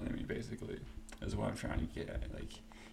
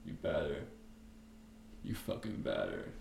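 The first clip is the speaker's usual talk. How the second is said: much lower, cold threat; mock menacing reply; 7 semitones lower, 4 dB quieter than usual. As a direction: growl it back like a calm tough guy. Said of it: "and Diaz just".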